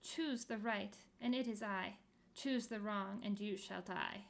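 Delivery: usual speaking voice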